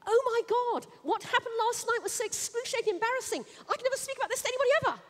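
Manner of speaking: high, rushed, breathless embarrassment; mock mortified gush. Friend confiding last night's embarrassing sex, high, rushed mock mortification. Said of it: "Instead, we go".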